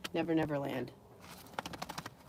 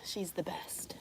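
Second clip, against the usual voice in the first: whisper; 15 dB quieter, slower than usual. Real, not acted. Whispering about her dog.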